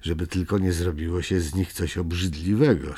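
plain reading voice

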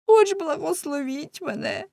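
high-pitched beggar's plea; humble pleading. Acted. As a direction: plead plaintively on your knees. Beggar kneels, pleads for a blessing.

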